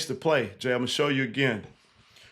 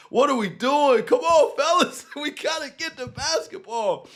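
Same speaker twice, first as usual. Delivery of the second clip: frustrated fan; 11 semitones higher than usual. Retold or imitated. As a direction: imitate upset Boston fans begging the players. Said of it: "Even the fans are like".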